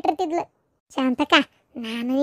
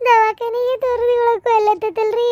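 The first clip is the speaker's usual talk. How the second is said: higher tearful plea through sobs; weeping mother, voice breaking; crying; 6 semitones higher than usual. Acted. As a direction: sob the words out to her husband. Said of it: "ಅಳಬೇಡ".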